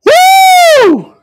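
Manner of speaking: loud high-pitched long "woo"; joyful whoop. Real, not acted. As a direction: whoop out in real elation. Overcome preacher whoops for joy.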